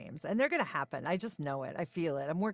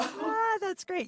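delighted; 6 dB louder, slower than usual. Real, not acted. Delighted reply to interviewer.

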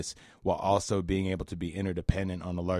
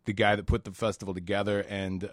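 ordinary talk, nothing marked

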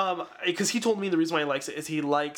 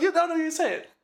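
high, insistent mock demand; hammed-up, high-pitched pestering; pissed-off character; 12 semitones higher than usual. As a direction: badger someone in comic exasperation. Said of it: "characters get pissed off".

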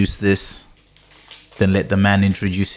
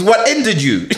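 ordinary talk, nothing marked

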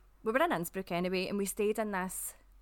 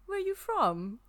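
high-pitched attempt at a German accent; put-on German accent, much higher voice; 10 semitones higher than usual. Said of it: "Was that your German accent".

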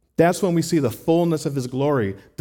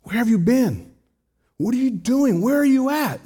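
much higher, louder and pleading, voice strained; raw, upset Martha; 11 semitones higher, 4 dB louder than usual. Retold or imitated. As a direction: demand answers from a friend who came too late. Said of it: "Martha is raw with Jesus".

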